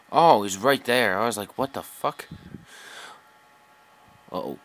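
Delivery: ordinary delivery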